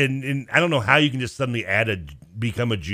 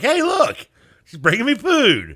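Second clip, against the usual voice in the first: excited; 13 semitones higher, 6 dB louder, slower than usual. Retold or imitated. Retold text to friend, excited.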